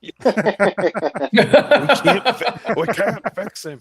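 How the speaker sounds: high, helpless laughing voice; words breaking up with laughter. Real, not acted. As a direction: try to talk through a big laugh. Laughing at Eric's accent.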